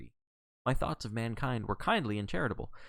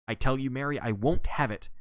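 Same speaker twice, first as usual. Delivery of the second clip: louder, higher quarrelling tone; indignant raised voice; 4 semitones higher, 5 dB louder than usual. A narrator's reading. John quarrelling with his wife Mary, mildly shouted. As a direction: firmly lay down the law, loudly. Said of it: "John repeated the words which had aroused me".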